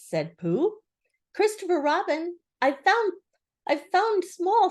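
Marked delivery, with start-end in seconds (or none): 1.3-4.7 high, loud, breathless-with-news announcement; excited Piglet. A narrator's reading. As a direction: squeal the news to a friend. Piglet excitedly announces his find.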